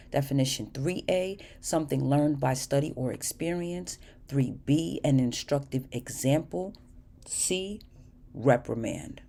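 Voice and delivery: soft, even reading of definitions; dictionary reading. Acted. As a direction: read the entries quietly, one by one. Reads dictionary definitions aloud.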